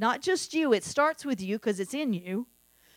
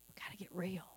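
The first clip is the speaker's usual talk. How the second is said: breathy whispered repeat; hushed whisper of the same words; 3 semitones lower, 18 dB quieter, faster than usual. Acted. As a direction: drop it to an intimate whisper. Repeated line whispered after plain take.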